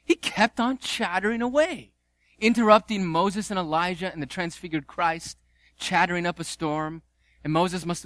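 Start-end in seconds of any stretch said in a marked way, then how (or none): none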